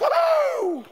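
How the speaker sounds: high, long whooping cheer; hammed-up whoop of delight; mock celebration. Preacher pretending you got the Starbucks job, excited whoop.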